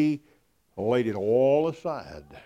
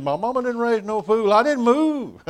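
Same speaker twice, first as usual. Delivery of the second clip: higher and louder, a comic rushed coworker; Randy's funny talk; 11 semitones higher, 7 dB louder than usual. Retold or imitated. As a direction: play the scared, wide-eyed country guy for the laugh. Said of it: "sometimes he could talk real funny".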